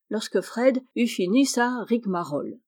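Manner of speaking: usual speaking voice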